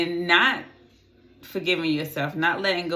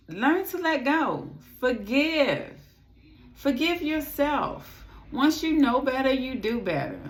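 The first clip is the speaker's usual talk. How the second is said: higher, pleading tone; heartfelt plea; 8 semitones higher than usual. Real, not acted. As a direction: plead with them gently.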